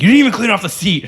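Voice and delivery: irritated complaint. Retold or imitated. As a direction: scold him for the wet seat. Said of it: "And she's like".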